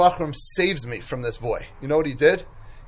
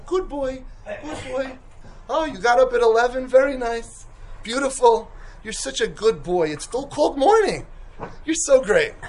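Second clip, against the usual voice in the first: mock praise; 5 semitones higher than usual. Mock praise for a hopeless boy.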